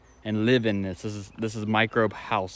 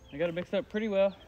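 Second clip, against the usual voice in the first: higher, strained while kneeling down; 9 semitones higher, faster than usual. Real, not acted. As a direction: talk while crouching at a pile. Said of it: "let me kneel down here".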